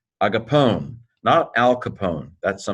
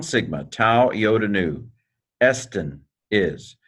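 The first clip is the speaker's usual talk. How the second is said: ordinary talk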